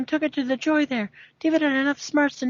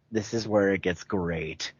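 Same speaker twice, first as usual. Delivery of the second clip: relish; 6 dB quieter, slower than usual. Real, not acted. Gleeful aside, slow.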